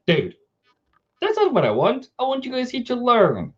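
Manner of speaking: imitating himself pleading with students who left; exasperated. Retelling a plea to students who dropped out, mock-exasperated. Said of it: "And I'm like".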